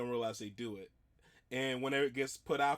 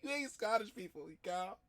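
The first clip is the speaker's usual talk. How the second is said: imitating Scottish outburst; 5 semitones higher, 5 dB quieter than usual. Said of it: "echoing the woman's outburst".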